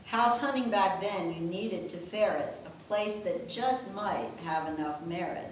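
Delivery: ordinary talk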